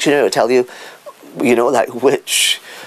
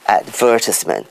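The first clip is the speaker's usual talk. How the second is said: "advertisement" stressed on the second syllable; 4 semitones lower than usual. Shown as a demonstration. Speaker models British usage, "advertisement" stressed on VER. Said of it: "the stress is on the second syllable".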